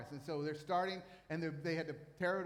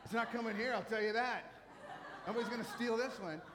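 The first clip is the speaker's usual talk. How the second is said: chuckling through a playful retold boast; laughing while quoting; 5 semitones higher than usual. Recalls his own secretive reply.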